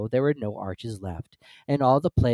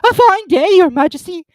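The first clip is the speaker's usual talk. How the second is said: usual speaking voice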